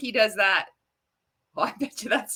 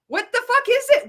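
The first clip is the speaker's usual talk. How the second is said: much higher, exasperated; demanding an answer from the screen; incredulous outburst; 12 semitones higher, 5 dB louder than usual. Real, not acted. Mocking the presenter, a high exasperated yell. Mocking reaction to a pseudoscience video.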